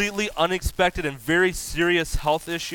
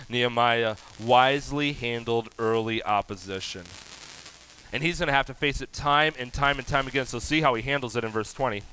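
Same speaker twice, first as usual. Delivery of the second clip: normal talking voice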